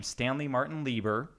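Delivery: ordinary delivery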